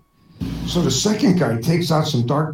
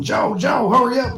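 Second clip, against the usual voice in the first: urgent call; 7 semitones higher than usual. Acted. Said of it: "runs into the bar shouting".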